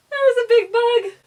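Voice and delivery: high, loud laughing squeal; real panicked bug alarm. Real, not acted. Sees a big bug.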